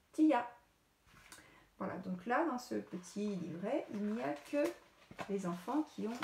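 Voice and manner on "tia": excited reveal. Acted. Teacher reveals last photo to class.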